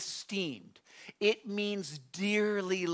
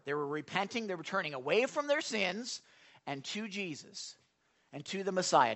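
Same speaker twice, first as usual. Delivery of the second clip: usual speaking voice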